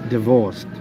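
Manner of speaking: usual speaking voice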